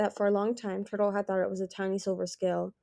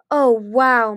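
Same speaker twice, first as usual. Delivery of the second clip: louder, drawn-in awe; awed; 4 semitones higher, 12 dB louder than usual. A narrator's reading. Mind Reader hearing of mind-reading classes, awed. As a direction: marvel at it, wowed. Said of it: "Mind Reader breathed".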